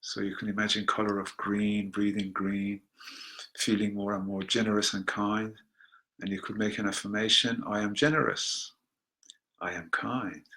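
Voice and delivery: very soft, low meditation voice; soft affirmation. Acted. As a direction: let the words sink in quietly.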